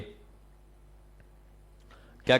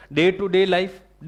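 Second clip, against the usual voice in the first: slow emphasis; 4 semitones higher, 5 dB louder, much slower than usual. Shown as a demonstration. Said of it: "teacher stresses key phrase".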